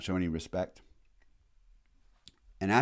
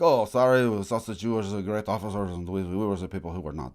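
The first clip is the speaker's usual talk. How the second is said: sarcastic jackass. Retold or imitated. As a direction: sneer a fake apology, hamming up the jerk. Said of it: "becomes a real jackass about".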